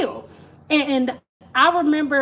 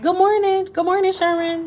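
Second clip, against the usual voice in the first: higher, bright sing-song good morning; cheery churchgoer greeting; 9 semitones higher, 3 dB louder than usual. Retold or imitated. Churchgoers greeting her dad in a higher sing-song. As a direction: greet him sweetly after church service. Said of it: "people would be like".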